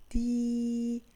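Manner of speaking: "دي" held as long, steady dii; drawn-out syllable "دي"; long vowel. Shown as a demonstration. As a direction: stretch the vowel of "دي" evenly. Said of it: "هو صوت".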